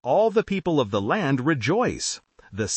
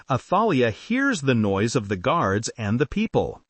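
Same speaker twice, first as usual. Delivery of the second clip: plain reading voice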